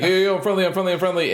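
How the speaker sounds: high, rapid-fire mock pleading; hammed-up harmless-stranger plea; imitated friendly plea. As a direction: call out as if begging not to be shot. Imitating a stranger pleading not to shoot.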